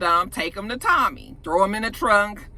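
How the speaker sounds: normal talking voice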